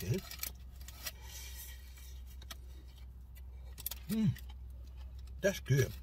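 eating while talking; speaking through a bite of food; mouth full, chewing. Real, not acted. Seen 0: Bit pastry just before; jaw and lips chewing while speaking.

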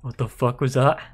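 jumpy fright at a sound; startled outburst. Real, not acted. Startled by a noise.

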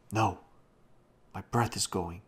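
mildly urgent objection; exclaimed. A narrator's reading. Old Da stopping Robbie as breath goes, mildly exclaiming. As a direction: cut in with a touch of force. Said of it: "exclaimed old Da".

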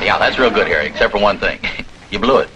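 usual speaking voice

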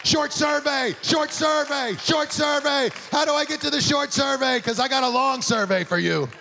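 very high, hammed-up shout, phrase after phrase; exasperated yelling. Caller demanding the short survey from phone system, comic high-pitched yelling. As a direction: yell it like a caller who has snapped.

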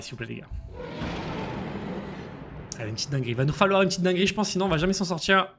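usual speaking voice

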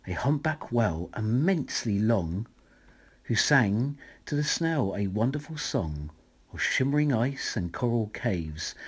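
ordinary delivery, nothing marked

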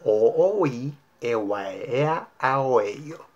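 "O, o, ui" as connected vowels, mouth shaping each one fully; vowels only, exaggerated. Shown as a demonstration. Teacher demonstrates a modulation drill, "a, a, o" in exaggerated vowels. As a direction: drop all consonants, stretch "e, ua, e" with the phrase's accents. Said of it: "Una última vez".

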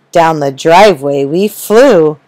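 plain reading voice

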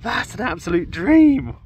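high and loud, bursting with delight; overjoyed. Real, not acted. Detectorist to his viewers over a gold coin, ecstatic. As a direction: squeal it out, thrilled to bits.

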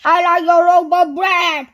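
high, very loud boom. A narrator's reading. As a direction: boom out the order at full volume. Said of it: "he boomed".

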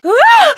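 short loud high scream; alarmed scream. Acted. Nancy gets a sudden fright and screams out loud. Game effort, alarmed cry.

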